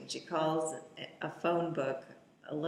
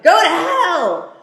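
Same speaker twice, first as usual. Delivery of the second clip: much higher, loud, slow defiant yell; defiant shout; 11 semitones higher, 17 dB louder than usual. Retold or imitated. Mia telling her captors off, slow defiant shout. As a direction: spit the words out slowly and loud.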